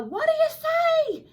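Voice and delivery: loud, very high cheer; high-pitched rousing holler; crowd joining in. A narrator's reading. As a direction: shout it as an eager crowd. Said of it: "the crowd all joined in".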